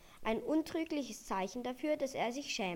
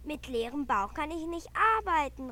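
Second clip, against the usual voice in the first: louder and higher, indignant reindeer; upset outburst; 5 semitones higher, 6 dB louder than usual. A narrator's reading. Balduin protesting to Jonathan, upset and loud. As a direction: blurt it out, fed up and hungry. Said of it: "rief Balduin aufgebracht".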